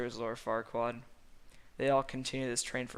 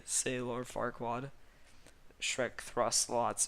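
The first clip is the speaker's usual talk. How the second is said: ordinary delivery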